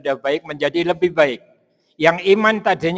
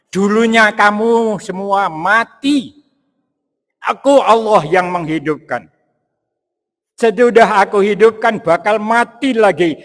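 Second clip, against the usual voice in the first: loud, higher, fervent sermon delivery; forceful preaching; 5 semitones higher, 6 dB louder than usual. Acted. Preacher explaining the verse to listeners, loud fervent preaching. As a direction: drive the point home to the crowd.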